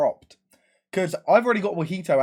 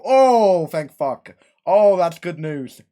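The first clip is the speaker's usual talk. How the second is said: big relief; 8 dB louder, slower than usual. Retold or imitated. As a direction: let out a huge relieved exclamation. Relieved reaction in retold call.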